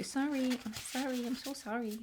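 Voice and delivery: giggly sing-song apology; mock apology. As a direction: apologise to the cards half-jokingly. Apologising after saying no cards.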